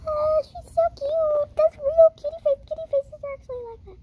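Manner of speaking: very high excited squeal. Real, not acted. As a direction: gush high over the cute kitty. Squealing at a cute kitty.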